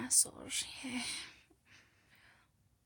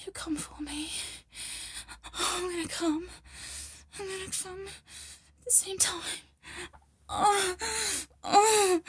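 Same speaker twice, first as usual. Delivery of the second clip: high, breathless cries, swelling loud then easing; climactic moaning; 6 semitones higher, 15 dB louder than usual. Acted. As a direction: gasp and moan urgently through the words. Acted climax in intimate roleplay.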